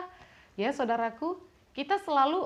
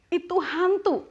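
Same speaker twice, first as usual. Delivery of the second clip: disciples' alarmed cry, higher and louder; startled cry; 8 semitones higher, 5 dB louder than usual. Acted. Disciples seeing Jesus on the water, startled cry. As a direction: cry out in sudden fright. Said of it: "mereka terkejut dan berseru".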